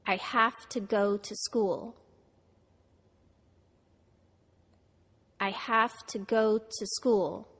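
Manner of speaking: "I have to go to school" with have, go, school stressed; stress on content words. Shown as a demonstration. Teacher models for students to repeat, content words stressed. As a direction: lean on have, go and school, reduce each to. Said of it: "Americans stress words in sentences that are important".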